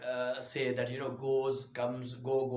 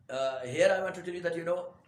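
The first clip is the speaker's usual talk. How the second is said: normal talking voice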